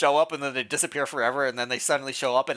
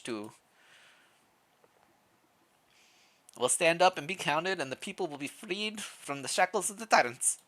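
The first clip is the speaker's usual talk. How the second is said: lightly voiced villager, vaguely Russian; wandering Russian-ish accent with pitch often lifted; 5 semitones higher, 6 dB quieter than usual. Sawmill worker pledging the village's support, vague Russian accent. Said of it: "Is that a Russian accent?".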